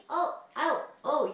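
ordinary talk, nothing marked

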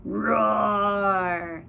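hammed-up tiger roar for the baby. Tiger voice again.

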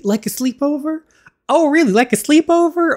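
girl mocking the boy's sleepover idea, high and scornful; mocking echo. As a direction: throw his words back at him, sneering and high. Other character mocks his words.

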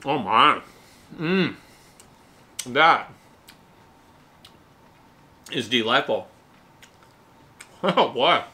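ordinary talk

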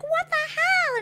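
high-pitched mock disbelief; exaggerated, squealed exclamation; mock outrage. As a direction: imitate an indignant "what the hell" way up high. Retelling her startled reaction to shooting.

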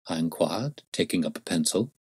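ordinary delivery

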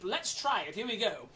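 plain reading voice

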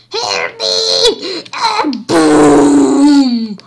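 loud crying yell. Pretend. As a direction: scream it out. Cry then explosion.

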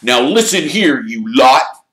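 loud, high scolding of the team; glowering team captain. A narrator's reading. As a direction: lay down the law to a whole team. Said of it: "glowering at them all".

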